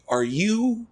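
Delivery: high, drawn-out questioning 'you'; hesitant recognition. Retold or imitated. Family asking the cafe worker, high hesitant recognition. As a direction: imitate someone tentatively recognizing a face.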